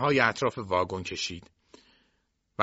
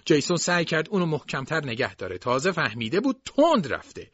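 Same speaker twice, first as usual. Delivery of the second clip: plain reading voice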